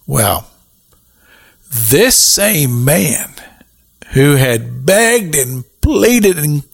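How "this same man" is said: usual speaking voice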